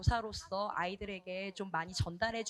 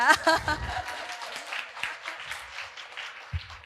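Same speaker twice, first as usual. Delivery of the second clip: laughter; 5 semitones higher than usual. Real, not acted. Speaker ending her feedback with an apology, laughing. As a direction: burst of embarrassed laughter.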